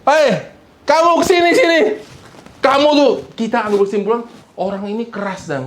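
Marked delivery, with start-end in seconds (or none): 0.1-3.1 high, loud calling out; shouting call. Retold or imitated. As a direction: yell at someone to come here. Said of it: "orang yang teriak manggil-manggil". (teriakan memanggil yang keras dan tinggi)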